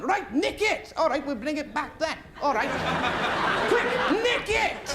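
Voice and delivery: hammed-up gang urging each other on; mock Welsh thieves. Welsh thieves urging each other to steal the car, hammed-up. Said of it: "And they're going".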